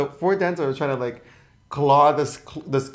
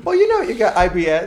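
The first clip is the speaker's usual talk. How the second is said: talking through laughter, pitched up; laughing; 8 semitones higher, 7 dB louder than usual. Real, not acted. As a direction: giggle the words out high. Laughing at joke.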